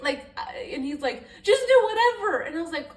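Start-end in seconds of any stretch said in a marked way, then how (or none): 1.4-2.4 much higher and louder imitation of a fed-up boyfriend; mock annoyed shout. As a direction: yell it like he's done with the question.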